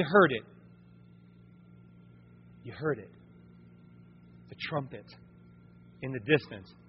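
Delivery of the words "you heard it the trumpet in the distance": soft and low like a far-off sound; hushed suspense. Acted. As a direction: lean in and let it drop to a hush. Building suspense of distant trumpet.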